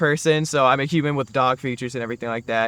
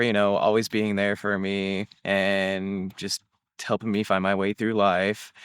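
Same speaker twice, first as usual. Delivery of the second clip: tender thanks; 5 semitones lower, 5 dB quieter than usual. Real, not acted. Thanking his mom, emotional.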